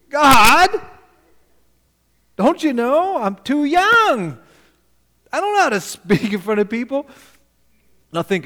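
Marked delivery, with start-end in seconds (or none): 0.1-7.1 high, loud pleading whine; whiny Jeremiah. Retold or imitated. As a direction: beg God like a frightened boy. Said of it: "first words out of his mouth".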